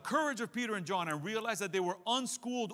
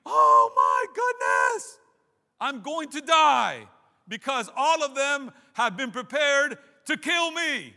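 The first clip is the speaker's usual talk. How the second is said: loud, slow, panicked cry of a terrified player; panicked inner scream; 6 semitones higher, 12 dB louder, much slower than usual. Retold or imitated. Young player's brain screaming in panic at the line, loud and slow. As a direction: scream it like you're about to be flattened. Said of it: "your brain is screaming".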